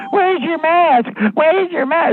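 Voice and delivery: high, slow mocking parrot voice; mocking mask nag. Mocking the mask scolds in a high, slow parrot voice. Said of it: "the parrot mouthy ones going".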